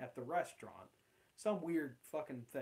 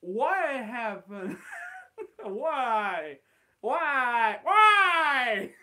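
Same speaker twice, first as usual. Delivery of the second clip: drawn-out, rising mock cry of why; mock wailing; 7 semitones higher, 11 dB louder, much slower than usual. Driving home after the awkward date offer, mock wailing. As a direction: wail it louder each time, half laughing.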